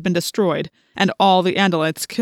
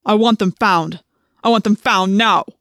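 loud, imperious villain order; Visser's loud, angry command; forceful command; 7 dB louder than usual. A narrator's reading. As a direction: hammer out the demand like a tyrant. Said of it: "at the Visser's command".

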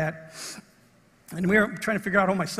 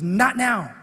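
sharp, louder retold warning; menacing warning; 3 semitones higher, 5 dB louder than usual. Lookout warns off the approaching preacher, menacing. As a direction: warn off an intruder, quick and firm. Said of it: "like that".

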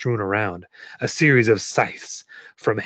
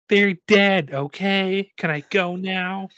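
put-on high child's voice, impatient; high-pitched girl wanting to leave; little girl; 7 semitones higher, slower than usual. A narrator's reading. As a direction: answer as a cornered little kid.

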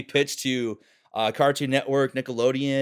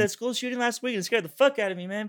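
higher stoner whine; lazy grumbling surfer kid; Zen voice; 9 semitones higher, 4 dB louder than usual. Pretend. Joking improvised cartoon line about school, whiny Zen voice.

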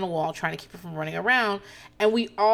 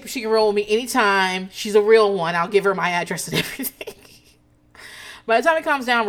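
usual speaking voice

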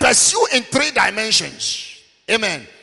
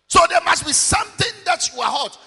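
ordinary talk

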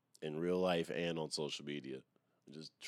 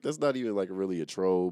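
loud protest; 8 dB louder than usual. Retold or imitated. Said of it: "I was like".

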